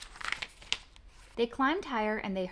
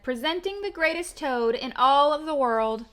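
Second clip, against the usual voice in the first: a hint of a grand, higher, louder announcer; 5 semitones higher, 9 dB louder than usual. Strange far-off voice presenting Toad on stage. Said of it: "A strange voice from far away said".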